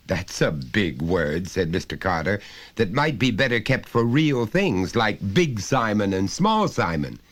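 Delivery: high, loud, lecturing father voice; a father talking down to his son, patiently insistent; stern father. Acted. Father pressing his small son, loud and high.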